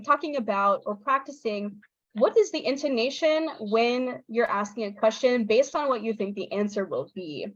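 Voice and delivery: ordinary talk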